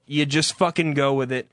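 usual speaking voice

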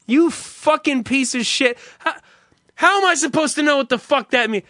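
normal talking voice

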